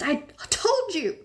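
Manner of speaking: high, slow insistent repeat. A narrator's reading. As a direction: drive it home, high and slow. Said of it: "Hermione gasped".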